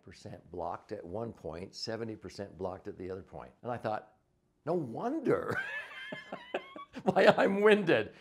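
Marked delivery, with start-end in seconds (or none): 4.7-8.1 laughing realisation. Retold or imitated. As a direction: laugh at how obvious it was. Realising the cause, amused.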